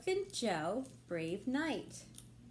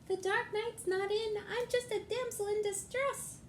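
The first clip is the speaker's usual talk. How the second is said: much higher, dainty damsel voice; damsel in distress; 11 semitones higher than usual. Acted. Dark Knight pretending to be a damsel, high sweet voice. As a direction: put on a sweet helpless damsel voice.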